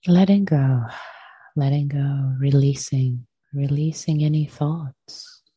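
unhurried meditation voice with long pauses; guided meditation. Acted. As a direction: gently guide them into stillness, leaving space after each phrase.

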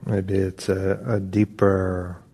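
unhurried meditation guidance with long pauses; calm, even voice, drawing out the phrase endings; guided meditation. Acted. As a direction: ease them gently into stillness, phrase by phrase.

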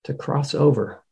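calm meditation guide, long pauses between phrases; guided meditation. Real, not acted. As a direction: guide them gently inward, leaving space after each phrase.

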